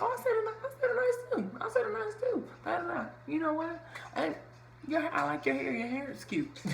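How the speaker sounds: very high-pitched playful mimicry; Angel. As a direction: mimic a woman in a squeaky high voice. Mimics Angel's twangy compliment.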